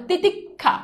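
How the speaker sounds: slow "তিতিক্ষা", broken as titik, then kha; split pronunciation. Shown as a demonstration. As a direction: hold apart the k and kh in "তিতিক্ষা". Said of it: "এই যে দেখো ক খ".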